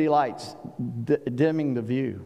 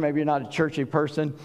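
ordinary talk, nothing marked

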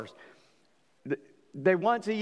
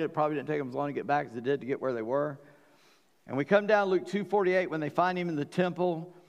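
ordinary delivery

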